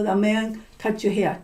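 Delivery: ordinary delivery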